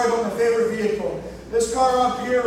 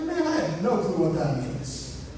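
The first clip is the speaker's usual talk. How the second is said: clueless me; 8 semitones lower, 5 dB quieter than usual. Retold or imitated. Baffled by the mechanic's jargon.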